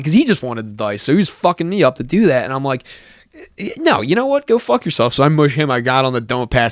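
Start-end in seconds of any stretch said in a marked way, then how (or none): 3.8-5.1 a much higher, indignant telling-off; retold angry brush-off, higher; high mock outburst. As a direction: spit it back at the hustler in a pitched-up voice. Telling off the hustler.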